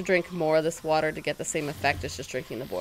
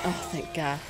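ordinary talk

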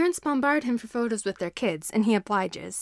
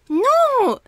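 a single high, loud refusal; screaming child; 11 dB louder than usual. Acted. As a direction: shriek it like a furious little kid. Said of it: "screams Shoto once more".

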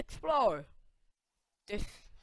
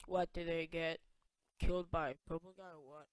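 normal talking voice

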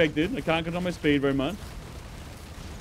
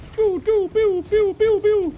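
high loud gun noises; pew pew sound; 5 dB louder, slower than usual. Pretend. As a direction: shoot pew pew like a kid.

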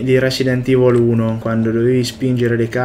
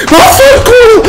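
loud, much higher "Vaffanculo!" spat out fast; furious scream; 11 dB louder than usual. Real, not acted. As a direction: scream it in rage. Repeated frustrated screams at game.

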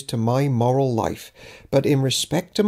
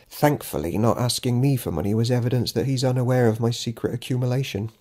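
ordinary delivery, nothing marked

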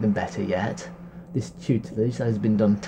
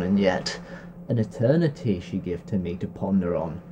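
plain reading voice

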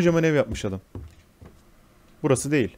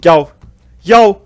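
loud, higher frantic shouting; panicked yell; 5 semitones higher, 10 dB louder than usual. Real, not acted. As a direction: yell in panic to get rid of her. Panicked yelling at pursuer.

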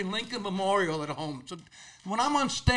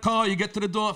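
ordinary delivery

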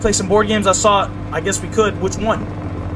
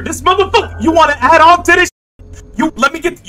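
higher, louder outraged yell; outraged shout; 8 semitones higher, 5 dB louder than usual. Acted. Partner wants it spookier, she yells outraged. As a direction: yell at him for making it worse.

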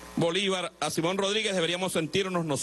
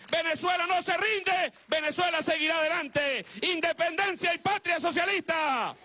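ranting dictator speech; 9 semitones higher than usual. Real, not acted. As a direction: fire up the crowd, shouting. Rally speech ending in chants.

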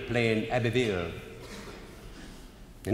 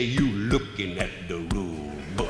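smug boast; 5 semitones lower than usual. Retold or imitated. Umpire beats his chest, proudly.

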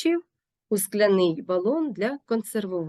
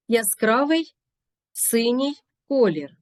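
ordinary talk

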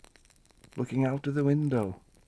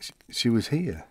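normal talking voice

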